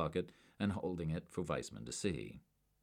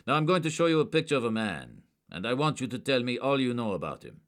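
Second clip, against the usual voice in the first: loud, higher and firm; stern demand; 7 semitones higher, 7 dB louder than usual. A narrator's reading. Agent demanding information from a suspect, stern. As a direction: demand answers.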